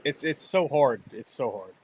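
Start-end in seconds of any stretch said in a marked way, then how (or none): 1.1-1.7 quiet, resigned echo of the line; softer repeat. Real, not acted. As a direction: lower and softer, fading out. Repeats the phrase, second time softer.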